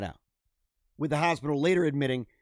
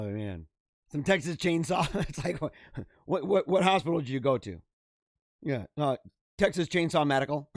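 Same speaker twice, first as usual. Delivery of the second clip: ordinary delivery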